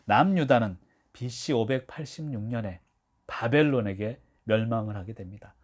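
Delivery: ordinary talk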